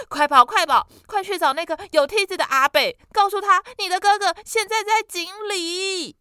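急促高声大喊; urgent loud orders, fast; frantic shout. Acted. 母亲急命弟弟去求救. (妈妈急得大声催弟弟快跑)

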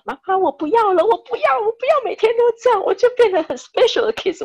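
mother imitating her crying son, high and loud; whiny crying child. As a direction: play the son wailing that he doesn't want this anymore. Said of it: "他哭着跟我说".

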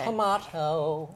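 drawn-out "Tomato" with a long AH; page-turn signal. Pretend. Host signals a page turn, stretched tuh-MAH-toe "Tomato". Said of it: "today's page turning sound shall be".

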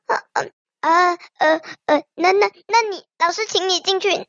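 usual speaking voice

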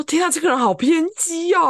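high, loud "what a drama queen" gasp; shocked. Retold or imitated. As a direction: recoil in disbelief at the message. Shocked inner thought quoted.

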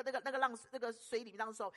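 ordinary delivery

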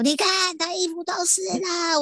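high-pitched mock nagging; host mimics her husband's nagging in a squeaky voice; imitating nagging husband. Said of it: "被我老公又狂念说".

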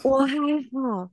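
slow, high, mock panting caller; breathy panting. Prank caller answering the worried agent, panting. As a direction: drag the words out on heavy breath. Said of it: "然后很喘".

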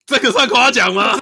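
loud indignant protest; mock outrage. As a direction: protest loudly, half laughing. Mock outrage at insult compliment.

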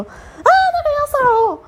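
high, loud alarmed yell; loud street yell. Shown as a demonstration. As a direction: scream it out to scare him off. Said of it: "当街对他大叫说".

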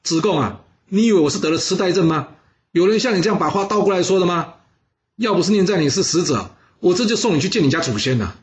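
high-pitched, indignant storyteller's rant; angry scolding. A narrator's reading. Minister berates envoy for talking backwards, angry scolding. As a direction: scold the envoy in acted outrage. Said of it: "他气得大骂说".

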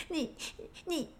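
high, sputtering angry stammer; too flustered to finish the scolding; stammering in anger. Retold or imitated. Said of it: "我气到讲话".

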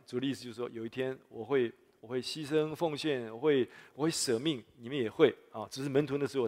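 ordinary delivery

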